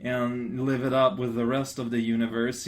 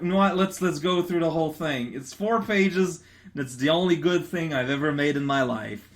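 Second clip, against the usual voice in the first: ordinary delivery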